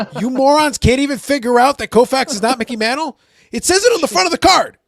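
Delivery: loud, high, furious retelling; exasperated rant. Retold or imitated. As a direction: yell at them like incompetent fools. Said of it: "And I'm like".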